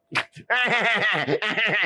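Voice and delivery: high cheeky giggle; gleeful giggle. A narrator's reading. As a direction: giggle at the prank. Oliver laughing at pushed sheep.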